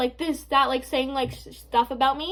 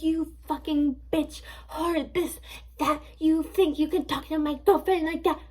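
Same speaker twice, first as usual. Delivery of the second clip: slightly higher mocking imitation of a girlfriend going off; mock angry rant; 3 semitones higher than usual. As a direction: imitate her rant in a put-on voice. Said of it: "going off on me".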